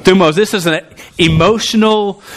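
normal talking voice

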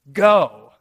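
a sudden loud command; one ringing word of command; commanding shout. Acted. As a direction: bark it out with full authority. Jesus casting out the demons.

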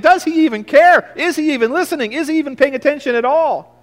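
high, pleading cries of doubt; anguished doubt. Retold or imitated. Believer voicing doubt about unanswered prayer, anguished high plea. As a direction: cry out to a silent God. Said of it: "and you think".

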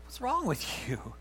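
hurt retort. Retold or imitated. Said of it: "you're like".

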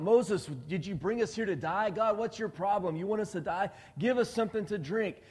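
high-pitched mock complaint of a crowd, climbing; crying out. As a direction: imitate a thirsty mob accusing their leader. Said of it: "they're crying out".

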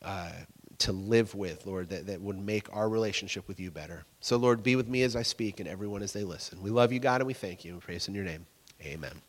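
low, soft closing prayer. Real, not acted. Pastor closing a prayer before the sermon, low and soft. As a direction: pray quietly, fading away to the amen.

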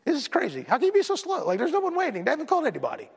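high-pitched mock exasperation; retelling an annoyed text to his wife; exasperated complaint. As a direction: whine it like an impatient patient. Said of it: "and I was texting my wife".

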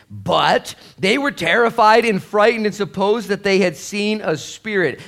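loud, high, slow preached reading; scripture reading. Acted. Pastor reading the verse to the congregation, loud and slow. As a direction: thunder out the verse.

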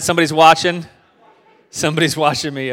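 playful excited singsong. Real, not acted. Joking with the crowd.

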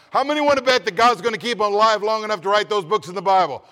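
loud soaring preacher's call; preaching at full force. Acted. Preacher rousing the congregation, loud full-force preaching. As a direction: rouse the congregation, booming.

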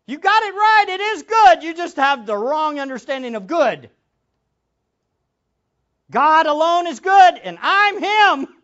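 high and loud, slowing onto "and I'm him"; triumphant shout of who he is; booming proclamation. Acted. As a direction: declare it at full preaching force. Jesus proclaims himself, preacher voicing.

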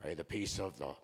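low, hushed, pious greeting; overly holy soft-voiced church greeter; fruity churchgoer. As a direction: murmur a mock-holy blessing, low and soft. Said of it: "Stop being so fruity".

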